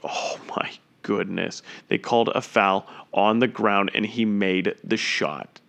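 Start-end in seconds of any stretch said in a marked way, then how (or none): 0.0-1.6 breathless whisper, slow and much softer; stunned whisper. Real, not acted. Commentator stunned by a foul-and-score, whispering. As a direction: whisper in disbelief at the shot.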